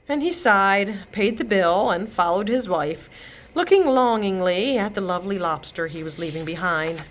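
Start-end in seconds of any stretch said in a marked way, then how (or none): none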